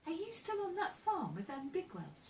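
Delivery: lightly voiced inquisitive girl; curious girl. A narrator's reading. Amy asks Joe about the farm, curious. Girl questions boy in story.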